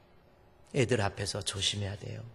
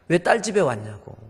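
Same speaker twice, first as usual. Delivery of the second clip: louder and higher, angry; retold angry shout; 7 semitones higher, 11 dB louder than usual. Father-in-law angrily tells his mother-in-law to leave. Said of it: "막 화를 내면서".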